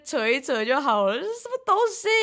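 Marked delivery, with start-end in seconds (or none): 1.1-2.1 incredulous. Real, not acted. Incredulous teasing question.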